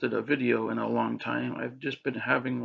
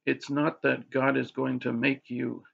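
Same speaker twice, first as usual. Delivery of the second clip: ordinary talk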